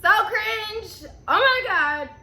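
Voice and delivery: high, loud embarrassed squeal; cringing laugh. Real, not acted. Embarrassed at own story.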